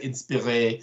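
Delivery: inspiré said the French way, with a nasal i and the stress on the final é; French pronunciation. Shown as a demonstration. Host jokes in mock French about the festival, pronouncing inspiré. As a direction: give inspiré a French ending. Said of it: "say it with a French, French accent".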